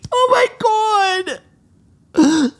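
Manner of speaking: very high, tearful squeal; overwhelmed. Acted. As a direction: squeal it, close to crying. Overwhelmed that Amber loves her.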